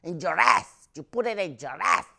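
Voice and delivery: high, loud mock mother; bossy mom. Mom instructing kid on talcum powder, high bossy voice. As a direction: order it like a bossy little mom. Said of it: "she's like".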